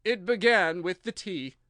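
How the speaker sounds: a hint of a nervous hatter thinking; slow hesitant character voice. A narrator's reading.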